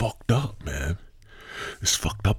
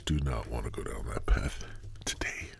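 low near-whisper, trailing away; hushed aside; 9 semitones lower, 13 dB quieter than usual. Real, not acted. Host steering himself off a bad topic, hushed aside. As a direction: drop right down as if warning yourself.

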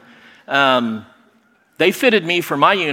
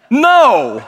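disbelieving no; 10 semitones higher, 7 dB louder than usual. Retold or imitated. Learns thirty rooms share it.

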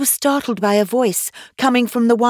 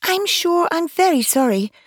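Alice voice; 11 semitones higher than usual. A narrator's reading.

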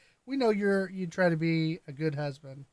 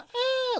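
high-pitched squeal in imitation of an excited little girl; high mock scream; imitated little-girl scream; 4 dB louder than usual. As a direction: mimic a little girl's scream, high but not louder. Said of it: "like the little girl scream".

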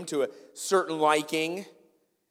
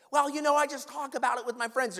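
high-pitched mock excuse; hedging excuse; 9 semitones higher than usual. Preacher playing a church member who skips the Bible, mock excuse. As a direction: imitate someone breezily dodging the point.

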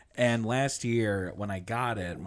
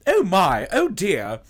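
high, loud, fussy droid impression; C-3PO; 6 semitones higher, 8 dB louder, slower than usual. Robot watching in the corner, high mock-fretting voice. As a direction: fret like a prim protocol droid. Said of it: "C three P O is like".

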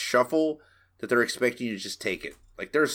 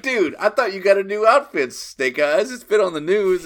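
higher, louder, teasing dude voice; mock buddy voice; 7 semitones higher, 6 dB louder than usual. Joking about Snake Eyes' outfit, in a hammed-up buddy voice. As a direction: ham up a surprised buddy. Friends mock a character's reaction.

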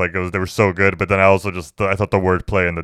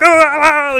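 high, loud "ah" cries; wild imitation of maniacs hollering; mock maniac yelling; 7 dB louder than usual. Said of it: "like a maniacs".

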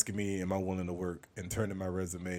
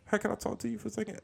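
high, fast eager voice; high-pitched; 13 semitones higher, much faster than usual. Retold or imitated. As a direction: squeak it out, eager to please. Playing both sides of a chat.

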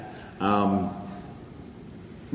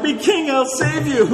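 much higher, slow hammed-up hero voice; comic dwarf hero; 13 semitones higher than usual. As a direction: declare the rescue like a goofy dwarf. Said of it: "And I'm like".

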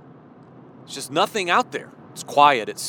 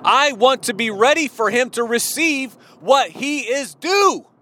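rising fervent preaching; 7 semitones higher than usual. Real, not acted. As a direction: build the worship to a climax. Climactic preaching.

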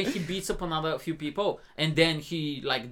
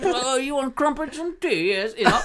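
mock British; 8 semitones higher, 4 dB louder than usual. Demonstrating how he sounds British, exaggerated British accent. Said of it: "He sounds British".

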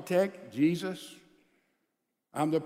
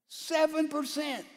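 "Seven percent" slow and higher, each word weighed; stress on seven; 7 semitones higher than usual. Shown as a demonstration. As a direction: hammer "Seven percent" slowly. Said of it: "repeats the figure for stress".

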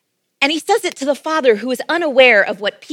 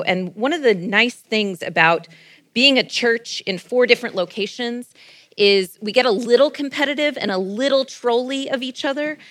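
ordinary talk

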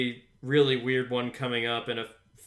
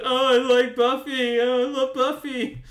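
high, louder mock gushing about Buffy; higher mock gush; gushing mockery; 9 semitones higher, 4 dB louder than usual. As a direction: ham up the fan swooning. Mocking everybody gushing.

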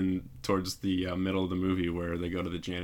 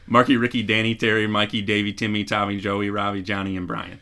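louder Boston accent on the second attempt; Boston accent, second try; 7 dB louder than usual. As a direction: another shot at a Boston accent, bolder. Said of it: "give you a second chance".

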